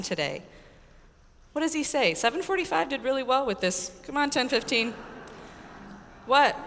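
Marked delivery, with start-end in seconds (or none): none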